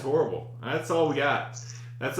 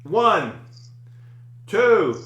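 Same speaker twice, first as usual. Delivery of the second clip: loud countdown; 4 semitones higher, 6 dB louder, much slower than usual. Real, not acted. Counting to group salute.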